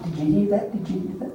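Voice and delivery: hushed, startled double-take; mock alert meerkat. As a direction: ask it softly, as if suddenly on alert. Acts men alerted like meerkats.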